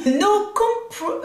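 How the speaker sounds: English attempt. Real, not acted. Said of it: "mon accent est magnifique".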